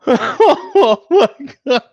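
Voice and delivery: high, loud, helpless laughter; cracking up for real; laughing hard. Real, not acted. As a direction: gasp it out through laughter. Cracking up on stream.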